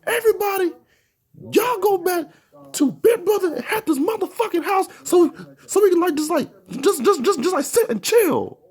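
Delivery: very high, strained voice ordering everyone home; bossy upset friend. Retold or imitated. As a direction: friend orders the group back to the house in a very high voice. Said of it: "he had said".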